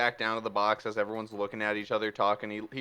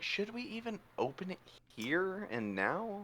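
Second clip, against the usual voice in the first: higher, softer worried question; cautious Rivera; 6 semitones higher, 9 dB quieter than usual. Acted. Rivera asking the party, higher soft worry. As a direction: voice the doubt quietly. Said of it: "Rivera's like".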